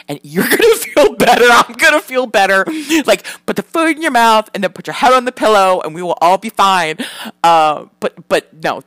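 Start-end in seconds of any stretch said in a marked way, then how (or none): 0.1-7.0 loud, pitched-up exasperated outburst; exasperated pleading. Retold or imitated. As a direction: plead loudly with the kids to just eat. Exasperated plea to the kids.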